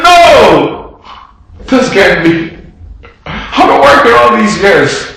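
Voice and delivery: high, loud cry of outrage; angry outburst. Acted. Older brother refusing the feast, loud and outraged. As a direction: burst out in disbelief.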